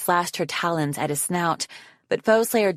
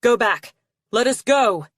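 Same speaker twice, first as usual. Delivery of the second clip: loud, higher-pitched shouted order; shouted demand; 6 semitones higher, 5 dB louder than usual. A narrator's reading. Arctic to the chasing guards, shouting. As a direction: shout at pursuers to back off. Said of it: "Arctic shouted at the guards".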